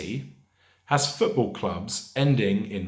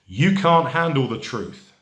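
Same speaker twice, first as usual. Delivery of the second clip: louder, higher outburst, mildly acted; forceful film quote; 6 semitones higher, 7 dB louder than usual. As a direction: deliver it as a mock courtroom outburst.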